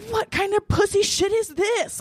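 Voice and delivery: high-pitched comic outrage; retold fury. Woman unleashing on her ex over the phone, furious. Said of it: "I was so furious".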